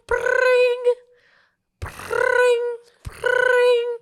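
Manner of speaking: high, loud, drawn-out ring imitation; be the ringing phone.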